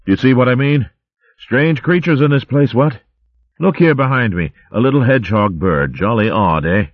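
a hint of a jolly old-chap hare; lightly voiced posh hare. Clecky chatting to Gerald in the line, cheery. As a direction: bluster like a cheery hare officer.

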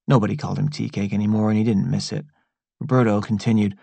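ordinary delivery

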